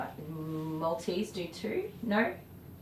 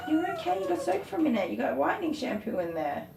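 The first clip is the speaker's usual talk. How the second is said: pet-talk voice; 10 semitones higher, faster than usual. Real, not acted. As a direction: coo at the wet dog. Talking to the soaked dog.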